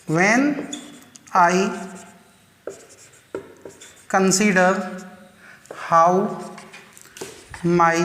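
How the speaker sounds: one word at a time, long pauses between; measured reading with each word set apart; word-by-word dictation. Shown as a demonstration. Teacher writing out a verse line for students, word-by-word dictation.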